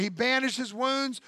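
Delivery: ordinary delivery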